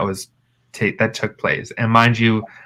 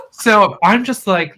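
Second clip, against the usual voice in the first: ordinary delivery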